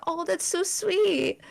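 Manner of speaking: high, slow, gushing coo; mock cooing. Mimicking fans over the sweet gang, high slow coo. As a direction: coo it like a touched fan. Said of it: "and you're like".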